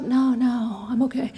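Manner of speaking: groggy, just-woke-up voice; lightly imitating a half-asleep friend; sleepy voice. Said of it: "you can hear that and they're, like, still lying in bed".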